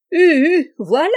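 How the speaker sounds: put-on high, loud, confused old sailor; a high, loud old man's bewildered query; old man's puzzled cry. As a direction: play a half-deaf old man who missed the word. Old deaf fisherman answers confused.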